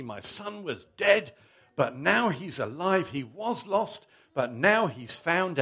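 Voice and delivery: loud, joyful father calling out orders; shouting for joy. A narrator's reading. Father calls out to servants, loud and overjoyed. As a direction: call it out to the whole household, overjoyed. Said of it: "He shouted".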